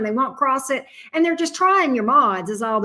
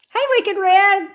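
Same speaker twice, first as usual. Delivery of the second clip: excited greeting; 9 semitones higher, 5 dB louder, slower than usual. Real, not acted. Greeting a viewer, high, slow.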